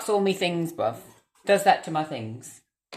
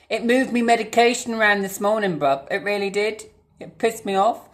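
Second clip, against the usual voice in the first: angry rant; 5 semitones higher, 5 dB louder than usual. Real, not acted. Angry about moved medication.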